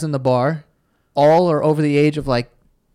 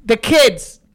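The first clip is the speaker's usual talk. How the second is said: high, slow mock-Boston echo; Boston accent; 8 semitones higher, slower than usual. Friend echoing the bartender's line for laughs, Boston accent. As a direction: savour it in put-on Boston. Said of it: "Those fucking accents are amazing".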